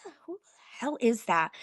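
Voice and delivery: breathless retold whisper of disbelief; stunned whisper to herself; whispered aside. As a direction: hush it like a private thought. Retold stunned thought at the bar.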